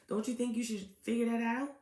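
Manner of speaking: normal talking voice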